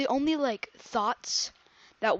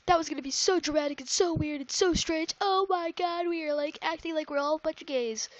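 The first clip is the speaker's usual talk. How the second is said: high, loud, gushing and fast; excited friends; 7 semitones higher, 5 dB louder, faster than usual. Retold or imitated. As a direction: ham up the friends' excited chatter. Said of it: "all my friends are like".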